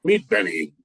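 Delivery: ordinary talk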